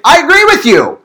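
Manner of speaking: high, loud hearty agreement; emphatic agreement. Retold or imitated. Preacher explaining teenage slang, emphatic agreement. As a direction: act out wholehearted endorsement. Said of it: "You were saying".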